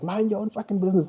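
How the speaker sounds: high-pitched mock indignation; retold angry retort. Boyfriend telling comic off mid-argument, mock angry. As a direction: snap it back, without real shouting. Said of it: "shouting at each other".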